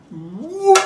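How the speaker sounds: high, loud whoop; whoop of delight. Real, not acted. Flipping top with a stick, sudden high loud whoop. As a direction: let it burst out.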